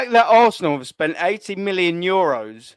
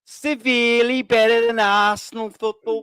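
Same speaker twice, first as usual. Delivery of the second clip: mock Scouse accent; 2 semitones higher than usual. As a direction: put on a hammed-up Liverpool accent. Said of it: "Just like I'm doing now".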